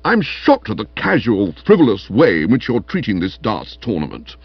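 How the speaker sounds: stern, worked-up reproach; hectoring Stilton, indignant. Acted. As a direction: lecture a slacker like an officious trainer. Stilton scolds Bertie over darts.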